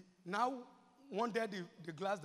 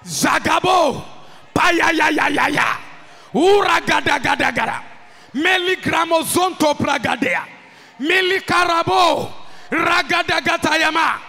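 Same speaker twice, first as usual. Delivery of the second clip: loud rapid glossolalia; shouted tongues; 6 semitones higher, 16 dB louder, slower than usual. Real, not acted. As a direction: pour out tongues at full voice.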